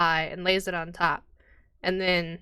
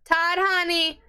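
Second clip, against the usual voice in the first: higher call across the tavern; 10 semitones higher, 4 dB louder, slower than usual. Acted. Barmaid calls her husband from the bar, pitched up. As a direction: call to your husband in the back.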